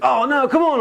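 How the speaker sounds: high-pitched mock wail of protest; pleading protest. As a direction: imitate begging through a locked door. Locked-out brother pleading.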